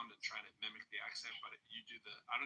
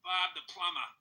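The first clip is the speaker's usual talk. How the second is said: put-on loud, higher Boston-accented ad voice; 7 semitones higher, 10 dB louder than usual. Mocking a local commercial, loud Boston accent. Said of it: "a commercial for".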